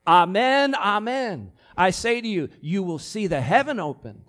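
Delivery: loud emphatic Amen, amen, then a falling close; emphatic proclamation. Retold or imitated. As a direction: declaim it like a solemn oath. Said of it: "a way Jesus emphasizes what he wants to say".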